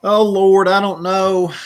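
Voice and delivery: sudden loud, high "Oh, Lord"; startled exclamation. Real, not acted. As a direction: caught off guard by the yelping bird. Bird yelps, startled reaction.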